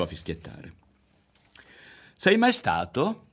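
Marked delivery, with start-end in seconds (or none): 2.2-3.3 boy's casual question, voiced high; a boy asks, trying to sound offhand; feigned indifference. A narrator's reading. Berto asks Ario casually, pretending not to care. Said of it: "domandò con indifferenza".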